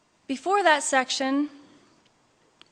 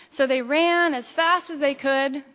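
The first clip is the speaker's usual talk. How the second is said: usual speaking voice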